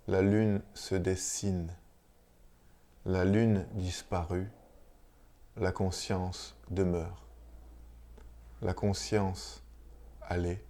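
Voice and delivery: measured, solemn reading of a mystical chant; poem recitation. Acted. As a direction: recite line by line, with long pauses between phrases. Lit un chant mystique à voix haute. (lecture poétique, lente et solennelle)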